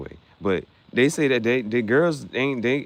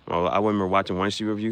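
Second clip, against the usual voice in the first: ordinary talk, nothing marked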